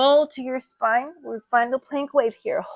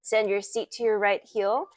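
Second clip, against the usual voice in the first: ordinary talk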